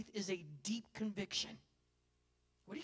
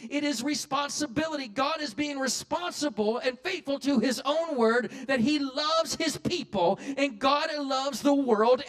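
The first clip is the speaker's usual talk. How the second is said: loud, slow, rising sermon; full-force preaching; 6 semitones higher, 15 dB louder, slower than usual. Real, not acted.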